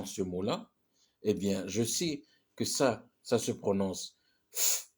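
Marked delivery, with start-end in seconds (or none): 4.5-4.9 F as a bare hissed "fff"; F said as pure friction, no "eff"; sound, not letter. Shown as a demonstration. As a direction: blow a long F sound with no vowel. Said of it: "c'est le son F".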